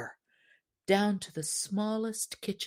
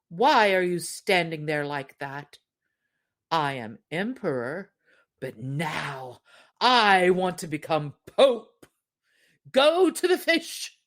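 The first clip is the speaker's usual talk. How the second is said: imperious wife; 5 dB louder than usual. Acted. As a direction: bark orders at the husband. Wife demands to be pope.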